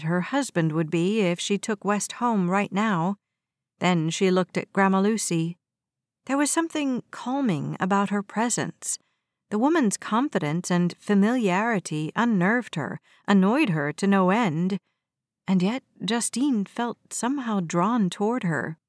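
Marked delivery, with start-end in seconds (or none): none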